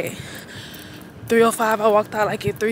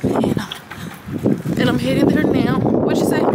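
ordinary talk, nothing marked

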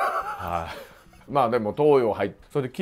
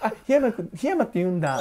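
put-on higher-pitched girl speaking standard Japanese; 標準語の女の子; 9 semitones higher than usual. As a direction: imitate a Tokyo-standard girl saying 檜山, pitched up. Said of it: "標準語の女の子と初めて喋るわけですよ". (標準語の女の子を高い声で真似)

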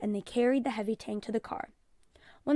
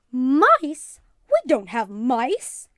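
alarmed; 2 semitones higher, 11 dB louder, much slower than usual. Acted. Mother alarmed at mice idea.

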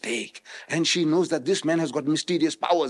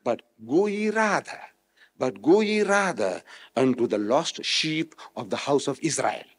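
ordinary talk

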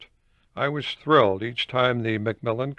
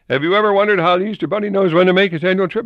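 higher, louder, fast mocking question; hammed-up fast reread; 8 semitones higher, 6 dB louder, faster than usual. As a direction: rattle it off in mockery. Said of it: "Editor was telling me".

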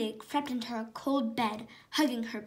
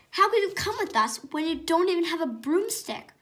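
mocking big sister; 6 semitones higher, 5 dB louder than usual. A narrator's reading. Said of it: "Polly asked".

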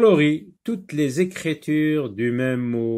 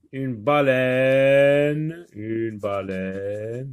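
slow modelling; 4 dB louder, much slower than usual. Shown as a demonstration. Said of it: "une baleine maintenant".

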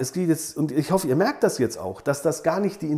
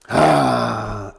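annoyed groan; 6 semitones lower, 5 dB louder than usual. Real, not acted. Annoyance at exam mistake.

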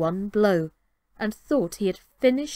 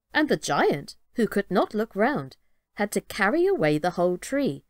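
ordinary delivery, nothing marked

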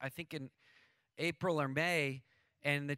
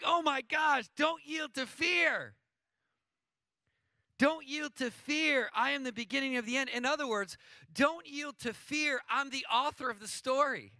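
raised, loud, driving preacher voice; full-force preaching; 7 semitones higher, 4 dB louder than usual. Acted. Pastor to congregation, preaching at full force. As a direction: preach it hard to the crowd.

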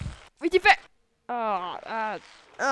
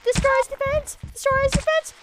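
high-pitched excited command; excited urging; 10 semitones higher, faster than usual. Real, not acted. Gamer urges his army on, high excited command. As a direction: push them on, voice jumping up. Yelling orders in game.

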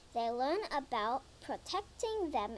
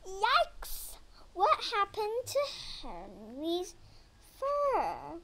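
surprised; 5 semitones higher, slower than usual. Acted. Shocked reaction in story.

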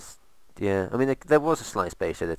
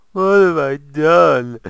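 much higher, much louder, slow mock anguish; melodramatic wail; 9 semitones higher, 16 dB louder, slower than usual. Gamer mocks his own build error, loud slow wail. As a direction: stretch it out like a tragic hero.

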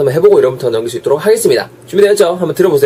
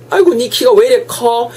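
high, drawn-out amazed question about height; exclaiming mnemonic; 10 semitones higher than usual. Shown as a demonstration. Teacher acting surprise at someone's height, exaggerated exclamation. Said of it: "요 느낌의 커 뱉으시고".

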